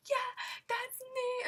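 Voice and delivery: high, loud, drawn-out squeal; retold excited yell. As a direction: confirm it in a slow, thrilled yell. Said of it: "yelling at them".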